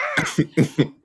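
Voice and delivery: shrieking high laugh; high burst of laughter. Real, not acted. Laughing at the joke.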